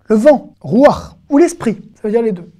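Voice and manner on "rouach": usual speaking voice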